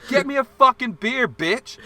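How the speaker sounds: loud, high yelled demand; retold shouting. Demanding a beer from the bartender, loud and high. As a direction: shout at him like you own the place.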